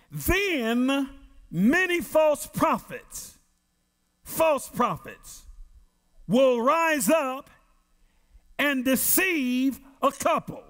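slow, weighty scripture reading; slow, solemn preacher's reading; preaching scripture. Acted. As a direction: let each phrase land like a warning. Reads scripture in preaching cadence.

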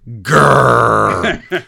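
loud drawn-out "grrr"; imitated growl, drawn out; mock growl. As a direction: growl it like an annoyed reply. Mimics writers' dismissive reaction.

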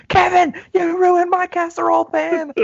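high, slow, loud shriek; shrieking mom. Retold or imitated. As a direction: wail it like an outraged mom. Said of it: "The mom ... goes".

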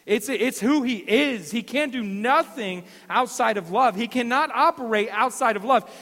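loud, high, slow emphatic preaching; fervent sermon climax; preaching at full force. Acted. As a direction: drive it home from the pulpit.

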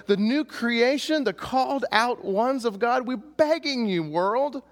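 impassioned high, loud, drawn-out plea; imploring loudly, high-pitched and slow; passionate pleading. Acted. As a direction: beg them with everything you have, stretching the words. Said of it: "He's doing it very passionately".

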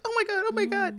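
high, panicky repetition; hammed-up brother in a panic; panicked brother. Brother after flipping the uncle out, mock panic. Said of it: "my brother was like".

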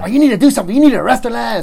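loud, high, imitated demand; mad car owners. Car owners demanding an arrest from officer, angry. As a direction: act out angry owners making a big stink. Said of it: "they start making a big stink".

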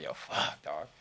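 hushed retold cursing; whispered curse. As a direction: swear to yourself so nobody hears. Muttered curse in retold moment.

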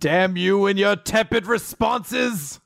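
high, very slow villain curse; sputtering foiled villain. Pretend. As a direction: spit it out like a beaten baddie. Continuing villain bit.

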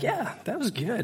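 high, pleased little self-quote; chuffed with how it went; self-satisfied quip. Retold or imitated. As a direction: pat yourself on the back, lightly. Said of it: "Some days I'm like".

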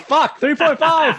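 high laughing words. Real, not acted. Laughs over a miniature mixup.

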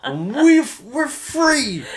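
high, loud mock-patriotic shout; over-the-top American yell; screaming patriot. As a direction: holler it like a hammed-up bar-stool patriot. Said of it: "standing in a bar stool screaming".

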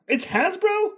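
high, rising "It's Hasbro?"; surprised question. Real, not acted. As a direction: echo it back in disbelief.

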